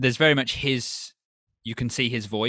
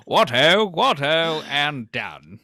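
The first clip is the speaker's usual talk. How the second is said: higher, slow posh toff voice; posh British toff; 4 semitones higher, 4 dB louder, slower than usual. Retold or imitated. Co-host mocks a posh Brit in bed, hammed-up toff voice. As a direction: ham up an upper-class "what ho". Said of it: "very British".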